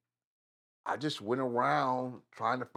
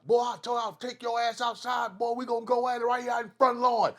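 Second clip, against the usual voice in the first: mock challenge; 11 semitones higher, faster than usual. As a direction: yell it like an uncle daring you outside. Uncles daring him to fight.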